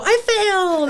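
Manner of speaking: high, loud happy cry; cheering as if failing were a win; gleeful group shout. Retold or imitated. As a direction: yell it proudly with arms up. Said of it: "And we would all go".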